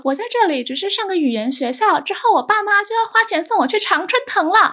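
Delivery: haughty bragging. Retold or imitated. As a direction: look down your nose and brag about the Ivy League. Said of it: "下巴抬超级高".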